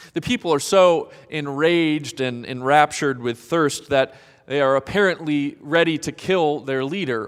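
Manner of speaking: usual speaking voice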